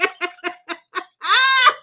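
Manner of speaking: high, helpless cackle; real uncontrollable laughing fit; laughing to tears. Real, not acted. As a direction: laugh until the tears come. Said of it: "I got tears running down".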